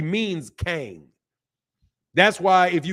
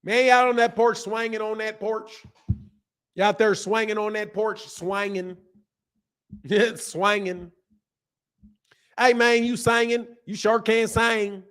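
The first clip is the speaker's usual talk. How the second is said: higher-pitched, twangy Southern porch talk; Southern accent; 6 semitones higher than usual. Acted. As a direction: stretch the vowels like a Southern porch sitter. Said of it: "That's Southern".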